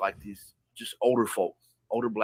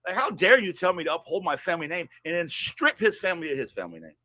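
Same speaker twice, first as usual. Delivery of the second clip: real anger; 8 semitones higher, 4 dB louder than usual. Real, not acted. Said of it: "and I'm mad".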